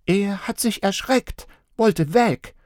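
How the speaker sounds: put-on high child voice; reporting child. A narrator's reading. Little girl reporting to parents, high child voice. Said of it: "berichtete Marla".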